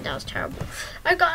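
ordinary delivery

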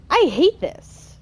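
high, loud, drawn-out exasperation; frustrated. Real, not acted. Player still stuck at the ceiling ladder, frustrated.

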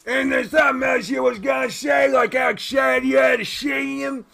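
high, loud cartoon voice; pushed-up, blaring host; boisterous restaurant host. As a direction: needle the guests at shouting volume.

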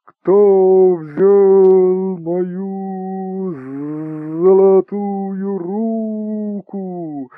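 very slow, high, loud howling ghost voice; wind spirit. Acted. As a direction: moan it like wind carrying a voice. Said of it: "голос, даже не голос, а будто ветер вперемешку с голосом".

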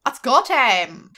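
loud, drawn-out Scottish-tinged rallying call; accidental Scottish. Acted. May psyching up for the party, Scottish-tinged shout. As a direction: slip into Scottish on a slow, loud cry. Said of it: "Kinda went Scottish there".

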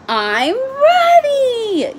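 a small frog bursting to go, yelling it out; eager little frog yell. A narrator's reading. Froggy shouting back to his father, high eager yell. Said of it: "yelled Froggy".

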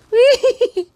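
short, high squealed mock sob; loud squeaky pretend wail; puppet crying. Puppet upset at not being a dog, squeaky crying. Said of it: "he's crying now".

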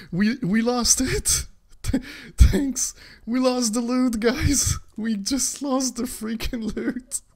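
high, laughing outcry; laughing disbelief. Real, not acted. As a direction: laugh through the loss. Laughing over lost loot.

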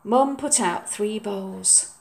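usual speaking voice

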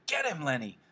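high-pitched urging yell, volume held back. A narrator's reading. George urges Lenny to fight back, yelling.

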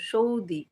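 "সৌদি" with a u glide after the o; ou pronunciation. Shown as a demonstration. Host settling the name's sound, "সৌদি" as shou-dee. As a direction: pronounce "সৌদি" as shou-dee, falling at the end. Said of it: "মাঝখানে একটা ইউ দিলে আরো ভালো উচ্চারণটা".

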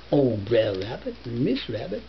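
ordinary delivery, nothing marked

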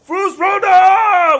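huge booming shout, slow and high. Shown as a demonstration. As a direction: bellow the dragon shout. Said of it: "we wanna actually hear him so go like".

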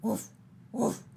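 short, loud woofs; dog's bark. Acted. Dog calling to the busy spider, barking. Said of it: "barked the dog".

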